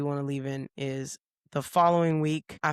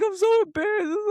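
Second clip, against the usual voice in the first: very high mock-tearful wail; imitated sobbing. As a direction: imitate an upset friend wailing in a high voice. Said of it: "started sobbing on the spot".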